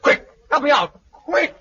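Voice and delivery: high, urgent panicked plea; frightened plea. Acted. Blind woman begs Bill for help after gunfire, panicked.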